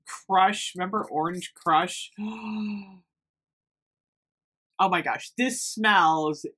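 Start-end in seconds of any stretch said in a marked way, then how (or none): 2.2-2.8 real gasp of delight; soft gasp of amazement. Real, not acted. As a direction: suck in a breath, thrilled. Delighted reaction to scent.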